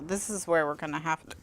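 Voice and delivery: ordinary talk, nothing marked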